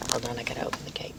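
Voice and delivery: quick whisper to herself mid-vlog; whispered aside. Real, not acted. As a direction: duck out of the story to mumble a quick whisper. Quick aside while driving.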